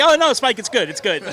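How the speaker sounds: mock reassurance. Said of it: "You just tell Spike".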